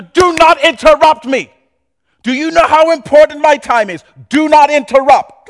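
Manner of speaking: loud, high, scolding dad; loud exasperated parent telling off a kid; irritated parent. Acted. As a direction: snap at the child for interrupting. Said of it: "parent snapping at kid".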